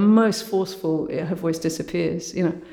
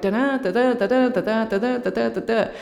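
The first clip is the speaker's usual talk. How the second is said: higher, evenly beaten da-da pulse; drumming rhythm; 5 semitones higher than usual. Shown as a demonstration. Speaker demonstrating Margaret Thatcher's school-teacher rhythm, drummed out in higher syllables. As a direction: beat out each da-da like drumming. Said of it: "like drumming the words into your head".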